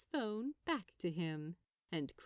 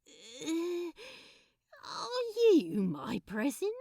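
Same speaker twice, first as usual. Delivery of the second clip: croaky; 8 semitones higher, 7 dB louder, slower than usual. Acted. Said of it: "he asked croakily".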